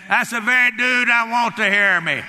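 loud, high defiant comeback; bold retort. Retold or imitated. Dad answers the fearful preacher, loud and defiant. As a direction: throw it back with full confidence.

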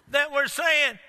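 ordinary delivery

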